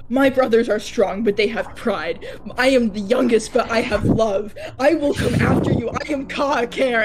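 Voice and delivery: screaming in anger. Acted. Player's character screams at a man while restrained, loud and defiant. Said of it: "I'm just screaming at him like".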